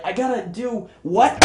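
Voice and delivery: high, acted disbelief; incredulous underling. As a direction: question the boss in alarm. Writer baffled by boss's order.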